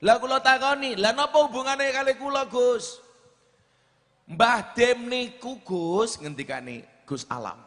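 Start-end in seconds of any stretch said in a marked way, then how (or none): 0.0-3.0 higher and louder, bewildered; puzzled raised voice. Retold or imitated. Retold own puzzled question. 4.3-6.2 earnest pleading quote. Retold or imitated. Gus Alam quoted pleading.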